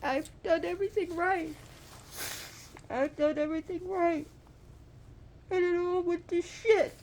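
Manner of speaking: very high, anguished wail; high-pitched tearful lament; acted crying. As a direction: wail it out as a heartbroken character. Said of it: "she's sitting there crying".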